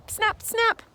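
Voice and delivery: high, sharp snapping sounds; turtle snap. Pretend. Guide snapping like a turtle for kids, high and sharp. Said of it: "snapping turtles snap with their beaks".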